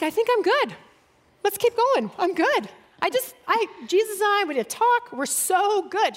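high, bright protest that she's fine; nervous deflecting. Retold or imitated. Telling Susie she's fine to avoid crying, pitched high.

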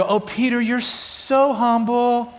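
drawn-out mock-admiring coo; mock praise. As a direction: gush over his humility a little ironically. Mock praise Jesus doesn't give.

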